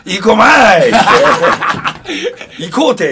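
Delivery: loud, high, laughing Nagoya dialect cry; mock Nagoya dialect shout. Joking how the song would sound in Gifu dialect, loud laughing Nagoya dialect. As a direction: belt it out in jokey Nagoya dialect, cracking up. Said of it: "あれ、名古屋弁、 岐阜弁やったら、".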